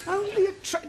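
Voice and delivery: incredulous. Acted. Incredulous echo.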